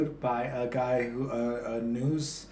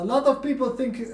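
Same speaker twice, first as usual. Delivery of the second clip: put-on German accent; 10 semitones higher than usual. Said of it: "I can't do the German accent".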